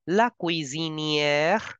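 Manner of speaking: "La cuisinière" with the middle s voiced like a z, "kwee-zee-nyair"; s sounded as z. Shown as a demonstration. Teacher demonstrating the z sound for students, careful. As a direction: say "cuisinière" buzzing the s as a z. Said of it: "please change the sound to Z".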